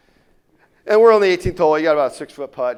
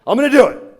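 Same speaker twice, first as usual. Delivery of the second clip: much higher, louder burst of resolve; fired up; 10 semitones higher, 10 dB louder than usual. Retold or imitated. Smoker committing to his daughters, loud fired-up cry. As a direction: shout it like you finally mean it. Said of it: "He gets all fired up".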